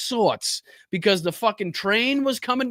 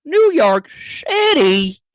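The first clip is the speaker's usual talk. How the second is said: higher, louder, slow mocking newsman; mock anchor; 8 semitones higher, 7 dB louder, slower than usual. Streamer repeating the host's line, slow hammed-up mock. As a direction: drag it out like a pompous host.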